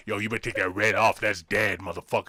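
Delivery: low, tough street ghost voice; gangster ghost. Pretend. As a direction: talk like a hard old-school gangbanger ghost. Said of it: "I heard this voice say".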